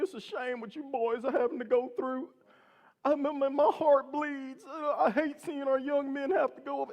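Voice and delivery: high, mock-tearful lament; hammed-up weepy voice, pitched high; imitated sobbing cop. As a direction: wail it mournfully, pretending to sob. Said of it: "this guy is sobbing".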